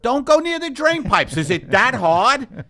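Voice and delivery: loud, high New York shouting; hammed-up New York exasperation, slowing on the question; New York accent. Warning jazz musicians off drain pipes, exasperated New York accent shout. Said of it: "that's my New York accent".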